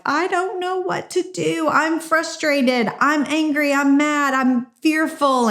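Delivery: high, anxious venting of frustration and fear; frantic frustration. Retold or imitated. Frantic on the court, venting to God, pitched high. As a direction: let it spill out, overwhelmed and stuck. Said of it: "I was like".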